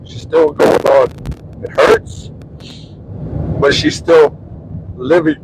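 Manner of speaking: halting, tearful voice with long pauses; choked up, crying. Real, not acted. Husband moved by a neighbor's strength, choked up. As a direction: fight back tears between short phrases. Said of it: "you made my husband cry".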